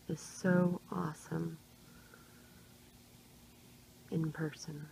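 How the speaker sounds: slow, sleepy whisper; half asleep, drowsy words; nodding off. Real, not acted. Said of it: "Sorry I'm talking so slow. I'm half asleep".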